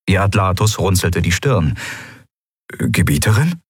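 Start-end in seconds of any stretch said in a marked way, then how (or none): 2.7-3.6 Gebieterin asked with a bewildered rising tune; a baffled, questioning Gebieterin; puzzled question. A narrator's reading. Aide puzzled by his ruler's reaction, rising question.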